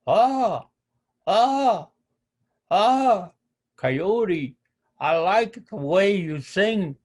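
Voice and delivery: high, loud cawing ahs, then mocking praise; cawing raven. The raven mocks crying Coyote, loud high caws. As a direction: caw like a raven before teasing.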